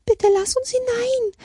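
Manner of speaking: very high, pleading squirrel voice; calling squirrels. A narrator's reading. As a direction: beg high like frightened little squirrels. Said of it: "riefen die Eichhörnchen". (hohe, flehende Eichhörnchenstimme)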